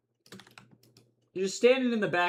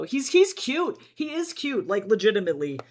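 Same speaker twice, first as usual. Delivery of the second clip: gushing; 4 semitones higher, 8 dB louder than usual. Real, not acted. Gushing about the character.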